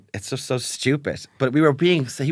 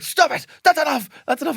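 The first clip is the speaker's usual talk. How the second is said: high, pitched-up mock shout; mock dad yell; 11 semitones higher than usual. Imitating dad shouting at noisy kids, high mock yell.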